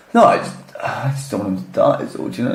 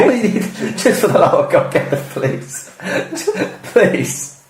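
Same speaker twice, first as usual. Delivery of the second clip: breathy, laughing plea; laughing, breathy plea for the police; 4 semitones higher than usual. Real, not acted. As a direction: giggle through the mock plea.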